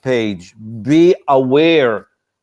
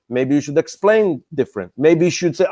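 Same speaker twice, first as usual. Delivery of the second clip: normal talking voice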